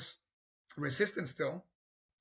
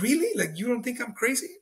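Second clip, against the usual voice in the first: incredulous; 7 semitones higher than usual. Retold or imitated. Recalling his reaction to the pastor's acceptance, incredulous and much higher. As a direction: voice jumping up in disbelief.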